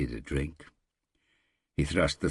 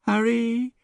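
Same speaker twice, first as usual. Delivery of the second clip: loud, high call of a name; 8 dB louder than usual. Acted. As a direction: shout his name across the forest. Someone calls out in the forest.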